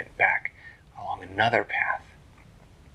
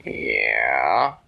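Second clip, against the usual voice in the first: lower, drawn-out yeah; a sleepy old turtle answering; lazy turtle voice; 9 semitones lower, 7 dB louder than usual. Acted. Turtle answering the girls, lower and drawn out.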